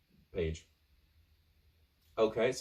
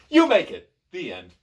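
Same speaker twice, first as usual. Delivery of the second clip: shouted, much higher cheer; triumphant; 5 semitones higher, 11 dB louder than usual. Acted. Reader announces the survival, loud and triumphant. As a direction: burst out with victory.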